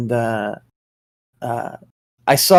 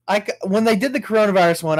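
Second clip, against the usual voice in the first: ordinary talk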